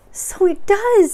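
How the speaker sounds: very high, loud, drawn-out squeal; excited Piglet. Acted. Piglet echoes Pooh's wonder, very high and excited. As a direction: squeak it slowly, pitched right up.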